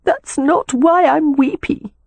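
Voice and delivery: high, slow, understated tearful voice; mildly weepy young sister; weepy protest. A narrator's reading. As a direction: protest through a touch of tears. Tearful sister answers her brother.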